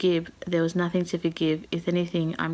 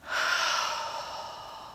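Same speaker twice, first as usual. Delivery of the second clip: long, shaky emotional sigh; real weary sigh; heavy sigh; 4 dB quieter than usual. Real, not acted. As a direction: let out a deep sigh of feeling. Emotional after finishing book.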